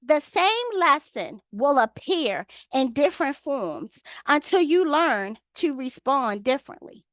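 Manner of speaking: slow, deliberate reading of a quote; measured, word by word with pauses. Real, not acted. Reading a quote to the ladies' Bible study, slowly. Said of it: "I'm gonna say it slow".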